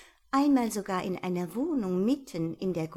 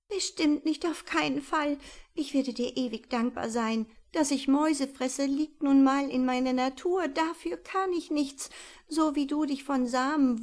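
higher, earnest owl voice; pleading owl; 6 semitones higher than usual. Acted. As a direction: plead your nature.

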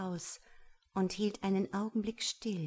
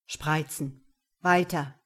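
curt order, building louder; commanding; 4 semitones lower, 6 dB louder than usual. A narrator's reading. Man goes on ordering woman, curtly commanding. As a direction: give orders that expect obedience.

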